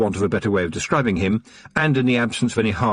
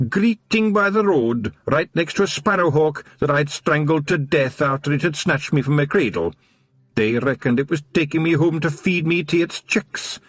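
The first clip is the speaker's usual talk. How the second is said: high Scots voice, a touch rueful; wistful Scottish watchman; 7 semitones higher than usual. A narrator's reading. Wee Mad Arthur telling the Feagles his past, rueful Scots. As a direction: tell it like an old sore tale.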